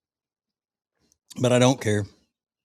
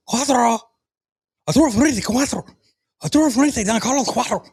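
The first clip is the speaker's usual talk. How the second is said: young show-off rolling the cigar names in a hammed-up Spanish accent, much higher on the names; mock the Spanish accent, climbing on Cuatro; mock thick Spanish accent; 3 semitones higher than usual. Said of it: "say it with as thick of Spanish accent as they can".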